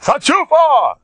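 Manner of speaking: shrill, loud scolding yell; screaming. Retold or imitated. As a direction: shout down the immodest women. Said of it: "I saw him screaming".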